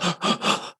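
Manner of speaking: imitated sobbing gasp; sobbing breaths. Crying son in mom's car, imitated sobbing breaths. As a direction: gulp air as if unable to get a breath. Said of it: "the sobbing, breathing, right?".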